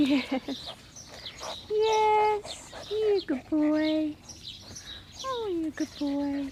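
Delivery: loud, slow puppy praise; squeaky praising a dog. Real, not acted.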